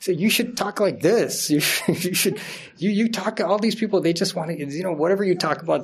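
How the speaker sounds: playful mock son, half laughing; imitating his son. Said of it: "So then he says".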